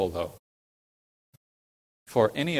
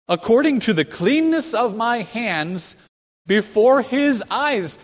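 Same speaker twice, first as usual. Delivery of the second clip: very high, loud, drawn-out proclamation; bold declaiming; 10 semitones higher, 8 dB louder, slower than usual. Acted. Preacher quoting David's claim to congregation, boldly declaimed. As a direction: proclaim each phrase slowly at full voice.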